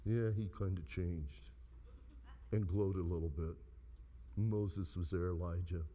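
low, unimpressed monotone; imitating a bored Bible reader, very low; mock dull reading. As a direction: read it like nothing remarkable happened. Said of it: "we read it like this".

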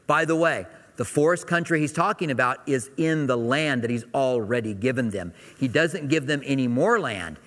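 usual speaking voice

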